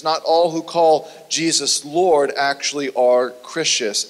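usual speaking voice

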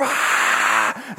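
long, airy crowd cheer; mock arena applause noise; imitated crowd roar. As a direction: breathe out a stadium's roar. Imitating the concert crowd roaring.

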